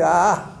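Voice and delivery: a long, loud open-mouthed "Ah"; loud ENT "ah". Shown as a demonstration. Teacher shows students the patient's "Ah", loud and open. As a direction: open wide and sing out "Ah" for the throat exam. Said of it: "¿Para qué te dice".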